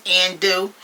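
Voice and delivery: normal talking voice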